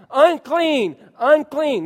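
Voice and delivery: loud, high warning cry; yelling unclean. Pretend. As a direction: call it out so they keep away. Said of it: "I'd have to yell".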